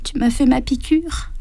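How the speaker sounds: high, frail voice of a dying woman; frail whisper. A narrator's reading. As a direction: breathe it out like a tired old woman. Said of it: "chuchota Émilienne". (chuchotement frêle de mourante)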